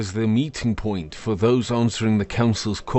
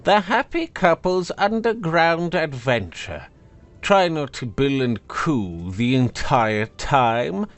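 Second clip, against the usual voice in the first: high, scornful magical blade; haughty sword voice; 7 semitones higher than usual. Acted. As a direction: sneer down at him from above. Sword sneers at couple.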